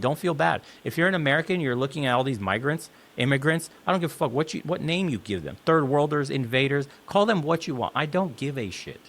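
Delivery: ordinary talk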